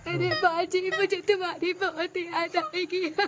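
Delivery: put-on slow, crying child's farewell; a weepy, slow sob through the words; tearful goodbye. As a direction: cry it out as she leaves. Child cries at bride's farewell.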